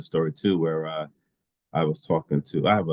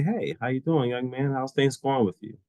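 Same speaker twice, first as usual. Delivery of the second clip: higher-pitched, friendly phone greeting; friendly check-in; 6 semitones higher than usual. Retold or imitated. Calling a young man to check in, friendly. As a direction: lift the pitch for an upbeat hello.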